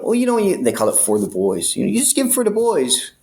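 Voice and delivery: high, coaxing cop voice; fake-friendly cop. Cop coaxing a bribe from the inmate, high sly voice. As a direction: coax him to pay for the boys. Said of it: "They go".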